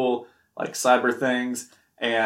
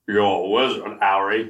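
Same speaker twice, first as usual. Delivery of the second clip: slightly low, put-on wizard-announcing voice; put-on character voice; 3 semitones lower than usual. Friend performs his voice on request, put-on character voice. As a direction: put on a silly character voice, a little lower. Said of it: "in your voice".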